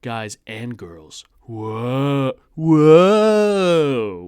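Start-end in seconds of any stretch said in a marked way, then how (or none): 1.4-4.2 loud, high, stretched-out whoa; big exclamatory whoa. Retold or imitated. Streamer reading a comment aloud, a huge drawn-out whoa. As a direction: read it like three exclamation marks. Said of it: "with, like, three exclamation marks".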